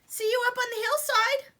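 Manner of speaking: high, loud call from afar. Acted. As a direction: call it up the hill. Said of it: "Little Billy Goat Gruff called".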